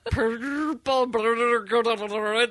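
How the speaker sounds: "purple burglar alarm" with rolled Scottish R's, drawn out slowly; a lightly put-on Scottish accent, stretching "purple burglar alarm"; mock Scottish. Shown as a demonstration. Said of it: "They, they usually go like".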